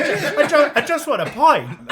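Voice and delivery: cracking up while protesting; laughing. Retold or imitated. Retelling his bar reply to pushy girls, laughing.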